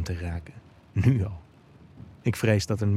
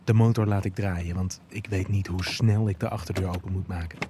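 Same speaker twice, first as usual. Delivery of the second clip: ordinary delivery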